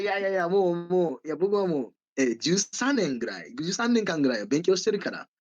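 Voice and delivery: ordinary talk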